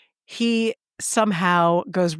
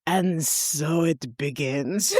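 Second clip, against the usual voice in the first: low, slow, doom-laden murmur; deadpan low and slow foreboding; ominous assistant; 4 semitones lower, 3 dB quieter, much slower than usual. Retold or imitated. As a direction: intone it like a prophecy of doom. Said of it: "runs in my head every day is".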